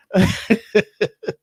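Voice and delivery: loud burst of laughter; a sudden loud laugh at himself. Real, not acted. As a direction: break into a big laugh. Laughing at own controls mishap.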